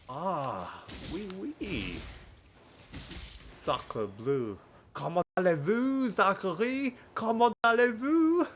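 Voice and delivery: hammed-up French accent, rising; playful fake French greeting. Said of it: "I have been doing French on, uh, a Duolingo".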